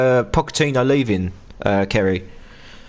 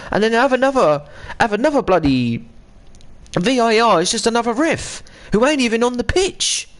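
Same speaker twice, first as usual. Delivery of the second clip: ranting about referees, voice pitched higher; a heated, rising rant at poor refs; 7 semitones higher than usual. Real, not acted. Fan ranting to his mate about VAR, heated. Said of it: "I had a little bit of rant, ranting and raving there".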